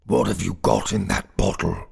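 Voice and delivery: loud goblin interrogation. A narrator's reading. Goblin questions the sexton about his bottle, loudly.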